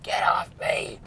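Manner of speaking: breathless whispered protest; defiant hiss. Retold or imitated. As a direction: hiss it like a man fending people off. He was so defiant.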